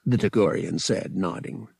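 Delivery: ordinary delivery, nothing marked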